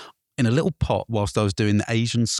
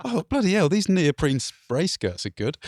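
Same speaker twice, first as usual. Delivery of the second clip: mock policeman; 6 semitones higher than usual.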